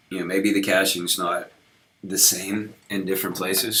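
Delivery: normal talking voice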